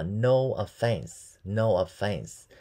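No offense stretched syllable by syllable; very slow model. Shown as a demonstration. Teacher drilling No offense very slowly for students. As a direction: draw each word out for learners. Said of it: "teacher demo, very slow".

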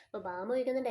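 ordinary delivery

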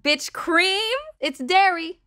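a sudden bright idea, hammed up a little; mock eureka moment; excited realization. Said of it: "I was like".

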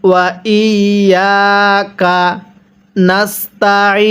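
slow, drawn-out ওয়া ইয়াকা নাস্তাইন; model recitation. Shown as a demonstration. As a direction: hold ইয়াকা and the final ee of নাস্তাইন. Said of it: "আরেকবার পড়ুন".

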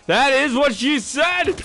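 high, loud, slow punchline; hammed-up joke. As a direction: belt the punchline slowly. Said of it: "Said the reader bot".

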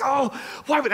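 high, exasperated self-reproach; retold frustration. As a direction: re-enact frustration with yourself. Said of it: "I'm so frustrated".

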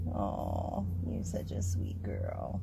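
soft whisper to a pet. Real, not acted. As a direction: breathe it sweetly to the dog. Whispered praise to the dog.

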